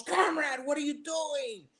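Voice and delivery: hammed-up Russian comrade, startled; Russian accent. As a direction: joke Russian accent, high and slow. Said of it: "and the Russian goes".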